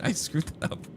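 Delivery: laughing at own flub; soft laugh through the words. Real, not acted. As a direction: crack up after botching the accent. Laughs at own botched accent.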